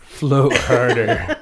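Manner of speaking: slow drawn-out laughing repeat; laughing echo. Retold or imitated. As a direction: stretch it out through a laugh. Echoing with laugh.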